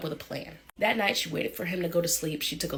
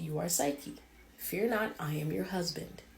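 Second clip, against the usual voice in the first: soft husband voice; 7 dB quieter than usual. Acted. Unseen husband speaks at night.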